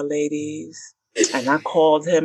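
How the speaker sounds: usual speaking voice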